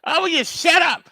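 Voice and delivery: put-on high, loud yell at a screaming baby; fed-up high shout; frustrated yell. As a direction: shout in exasperation. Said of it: "Gabby's whine".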